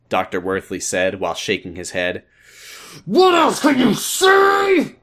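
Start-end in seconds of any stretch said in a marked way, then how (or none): 3.1-4.9 high, slow, loud outraged scream. Acted. Furious father screams at the doctor. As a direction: shriek the question back at him. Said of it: "George screamed".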